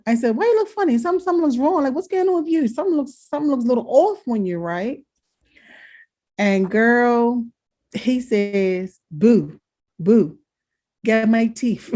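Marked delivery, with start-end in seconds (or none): 0.4-5.0 much higher, baffled questioning; incredulous. Retold or imitated. Daughter pressing her father about his face, baffled and high. 9.1-11.9 mock mumbling old man asking for his teeth; a light take on Dad calling his wife; imitating Dad. Father calling to his wife for his teeth, lightly imitated.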